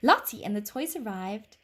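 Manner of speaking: ordinary delivery, nothing marked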